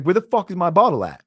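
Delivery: imitating his own pissed-off yell from back then; retold angry outburst. Finding his bottle gone, a retold angry outburst. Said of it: "Now I'm pissed".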